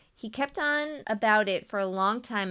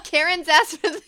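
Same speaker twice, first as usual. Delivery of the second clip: laughing; 8 semitones higher, 9 dB louder than usual. Real, not acted. Joke about Karen cracks her up.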